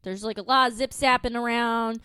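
ordinary talk, nothing marked

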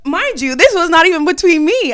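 loud, high-pitched insistence; emphatic protest. Real, not acted. Storyteller protesting being only the mediator, emphatic. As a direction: burst out with it to clear yourself. Emphatic aside about own role.

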